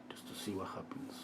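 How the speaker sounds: whispered aside while painting; whisper to self. Real, not acted. Painter testing a stroke, whispering to himself. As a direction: breathe it to yourself.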